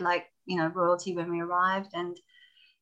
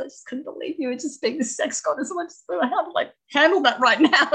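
higher, half-laughing confession; embarrassed laughing; 7 semitones higher, 4 dB louder than usual. Retold or imitated. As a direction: laugh a little while admitting it. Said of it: "sorry, I was laughing".

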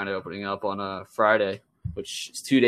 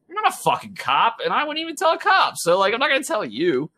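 much higher, indignant refusal; heated refusal; 11 semitones higher than usual. Retold or imitated. Retelling his heated refusal to the worker, much higher. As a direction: pitch soars as you refuse him.

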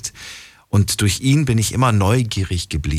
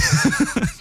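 short high-pitched laugh; quick giggle at own joke. Real, not acted. Joking about his parcel.

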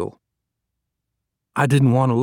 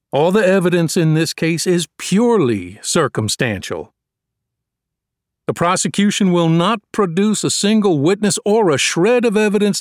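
building, raised and higher defense lawyer's pitch to the jury; courtroom oratory; 4 semitones higher than usual. Acted. Defense lawyer addressing the jury, warming up, rising oratory. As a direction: win the jury over with growing conviction.

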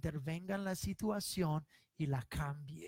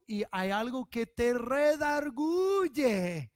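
builds to a slow, loud, higher shout; preaching shout; 3 semitones higher, 4 dB louder, slower than usual. Real, not acted. Preacher to congregation, rising to a slow loud shout. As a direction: drive it home to the congregation.

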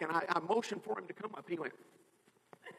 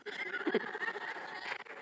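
high breathy laugh; 11 semitones higher, 7 dB quieter than usual. Real, not acted. Laughing at the donut request.

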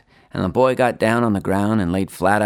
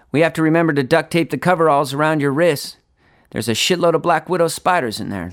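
a hint of a fast-talking salesman, pitched up; lightly voiced Lonnie; 6 semitones higher than usual. A narrator's reading. Lonnie selling Mister Herringfield the motorcycle, lightly voiced insistence. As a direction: talk up the bike with insistence, a little higher.

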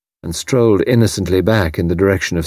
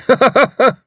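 high, loud barking laugh; hearty laugh; 4 dB louder than usual. A narrator's reading. Haydock laughs at joke.

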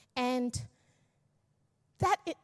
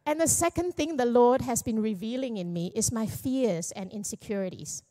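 ordinary delivery

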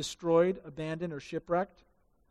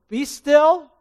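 loud, higher command to the storm; commanding call; 8 semitones higher, 10 dB louder than usual. Acted. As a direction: order the storm to stop. Jesus calms the storm.

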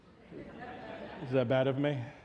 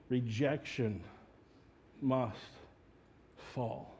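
slow, softer, each word set apart; solemn declaration; 6 dB quieter, much slower than usual. Acted. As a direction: let each word land. Sermon theme, slow pauses.

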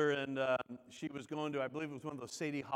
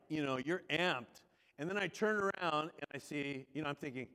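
ordinary delivery

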